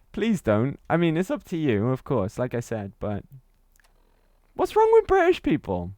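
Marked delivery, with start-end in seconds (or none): 4.5-5.9 high loud mock outrage; exaggerated offended protest; playful high indignation; mock indignant question. Real, not acted.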